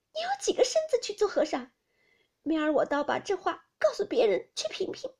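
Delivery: high, loud, offended telling-off; sharp scolding. Acted. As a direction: scold him hard for his careless words. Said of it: "登时将脸放下来".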